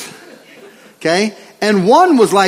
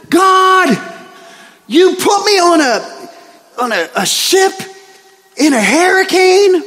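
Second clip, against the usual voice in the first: higher, louder, hammed-up rant at God; mock angry yelling; 9 semitones higher, 3 dB louder than usual. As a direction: pretend to lose it, shouting at God. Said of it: "and he starts yelling".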